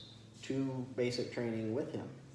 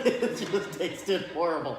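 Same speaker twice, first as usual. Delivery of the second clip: laughing higher and louder, drawn out; 5 semitones higher, 6 dB louder, slower than usual. Real, not acted. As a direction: say it through a laugh. Laughing over bad food memory.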